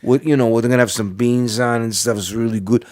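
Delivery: mocking Sopranos-style New Jersey accent; exaggerated TV New Jersey accent; make-believe Jersey accent. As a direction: imitate a fake Jersey mobster accent. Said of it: "talk with this make-believe accent".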